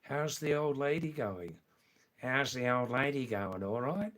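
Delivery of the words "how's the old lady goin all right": broad Aussie vowels, rising check at the end; original Australian accent. Shown as a demonstration. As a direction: ask it like an Australian mate. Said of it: "In original Australian".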